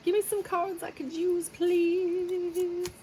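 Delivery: high squeaky plea to the cards; pleading. Pretend. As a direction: beg the deck in a tiny high voice. Pleading to the deck.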